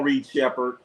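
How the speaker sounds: ordinary delivery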